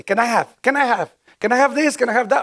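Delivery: an eager newcomer practising the English phrase; anxious, rising repetition of "Can I have this? Can I have that?"; nervous rehearsing. Retold or imitated. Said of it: "y yo me recuerdo así".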